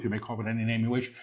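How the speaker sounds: rapid preview. Real, not acted. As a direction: rattle it off lightly before proclaiming it. Quick soft take before repeating aloud.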